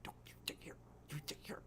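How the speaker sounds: urgent whispered order; hushed, imitated warning to stay put; secret warning. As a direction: whisper it like a covert signal. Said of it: "giving her eye signals, like".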